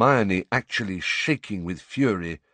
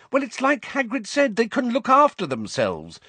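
impatient. A narrator's reading. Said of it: "said Hermione impatiently".

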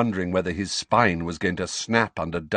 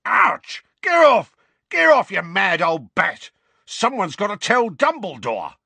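loud, high cries under blows; yelping protest; 11 semitones higher, 7 dB louder than usual. A narrator's reading. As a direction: shout to fend off an attacker. Mundungus is hit with a bag.

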